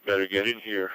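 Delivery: ordinary delivery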